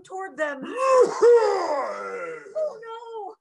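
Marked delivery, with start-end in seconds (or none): 0.7-2.6 long high roaring growl; loud bear roar. A narrator's reading. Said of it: "heard the loud roar from the bear". 2.6-3.3 high, soft, drawn-out dismay; small alarmed cry. A narrator's reading. Monkeys alarmed by the roar.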